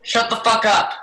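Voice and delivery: loud high yell. Acted. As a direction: bark at the noisy prisoner. Guard yells at prisoner.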